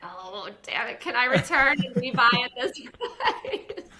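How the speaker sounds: oh damn it, then a loud high laugh through the price; mock dismay breaking into laughter; laughing regret. Shopper laughing at missing the dress sale. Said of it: "and you're like".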